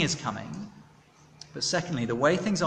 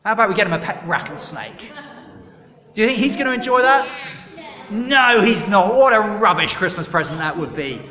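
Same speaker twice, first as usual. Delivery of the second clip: loud; 4 semitones higher, 5 dB louder than usual. Real, not acted. Preacher quizzing children, loud.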